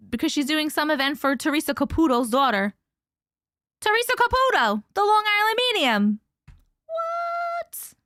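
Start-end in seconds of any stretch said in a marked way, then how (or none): 3.8-6.2 high-pitched, heavy Long Island accent. Real, not acted. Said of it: "His accent was really out". 6.9-7.9 very high, drawn-out squeak; squealed amazement. Acted. Mock amazement at the celebrity name.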